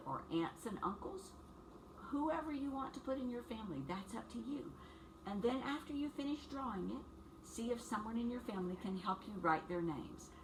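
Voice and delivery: ordinary delivery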